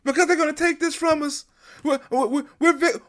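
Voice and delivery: high, plaintive mock complaint; high mocking voice of a whining protester; mocking whiny victim. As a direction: whine it like a self-pitying victim. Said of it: "We can't say".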